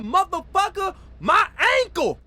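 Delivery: injured friend yelling out in pain. Retold or imitated. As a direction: yell it like your ankle just snapped.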